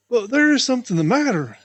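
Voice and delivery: loud, high insisting; insistent. Acted. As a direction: press the point hard. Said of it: "insisted Jerry Muskrat".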